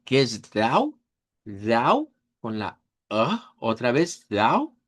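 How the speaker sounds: "dau" stressed and drawn out; stressed syllable dau. Shown as a demonstration. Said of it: "la sílaba tónica". ("dau" tónica y marcada)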